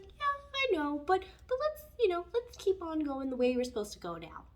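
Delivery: very high, soothing consolation; sympathetic universe. Pretend. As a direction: comfort them like a kind parent. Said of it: "And universe is like".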